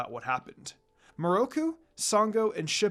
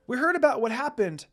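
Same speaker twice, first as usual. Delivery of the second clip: put-on child voice; 7 semitones higher, 5 dB louder than usual. A narrator's reading. Child character Shippo speaks.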